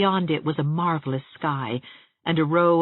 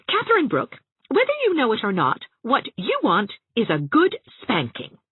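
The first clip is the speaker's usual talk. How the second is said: exasperated scolding; 9 semitones higher than usual. A narrator's reading. Anne losing patience, scolding.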